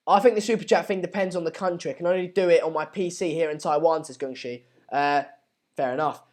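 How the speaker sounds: usual speaking voice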